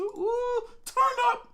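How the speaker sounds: high falsetto whoop and cheer; loud, squealed party shout; party hype. Real, not acted. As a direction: whoop it up for a celebration. Hyping a viewer's birthday weekend.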